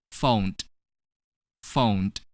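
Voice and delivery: "Phoned" as one syllable ending in d. Shown as a demonstration. Teacher modelling "Phoned" for learners, ed pronounced as d. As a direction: demonstrate "Phoned" with a voiced d at the end. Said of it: "we're gonna pronounce ed as d".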